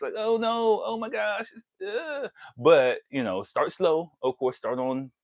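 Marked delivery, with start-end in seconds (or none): 0.2-2.3 put-on higher, flustered girlish squeal; mock embarrassed girl. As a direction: play her embarrassed, pitched up. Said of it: "she might be embarrassed".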